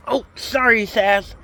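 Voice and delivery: high, loud sorry to the dog; sudden high-pitched oops; startled apology. Real, not acted.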